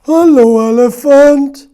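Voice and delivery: high, loud call of hello; story animal booming a hello; loud animal greeting. Acted. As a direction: greet the mouse like a big bossy animal. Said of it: "story animals voiced".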